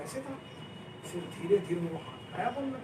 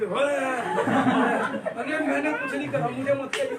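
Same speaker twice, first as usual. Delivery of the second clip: startled cry; 5 semitones higher, 7 dB louder than usual. Retold or imitated. Terrified friend startled awake.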